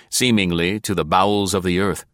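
plain reading voice

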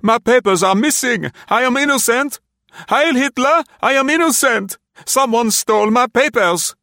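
high, loud desperate protest; crying out. A narrator's reading. Tackled man to German soldiers, crying out loudly. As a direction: cry out your innocence to captors. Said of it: "the man cried".

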